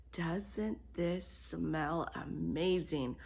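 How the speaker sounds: slow, soft, teasing question; slow savoring. Acted. Hen teases her friends with fresh bread, slow and soft. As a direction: draw it out, savoring the smell.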